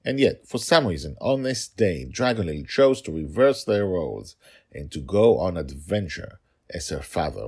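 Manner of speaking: ordinary delivery, nothing marked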